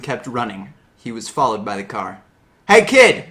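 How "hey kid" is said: put-on loud call after a kid; shout. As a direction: yell it out to stop him. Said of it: "I shouted to him".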